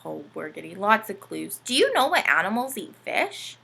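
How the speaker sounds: ordinary delivery, nothing marked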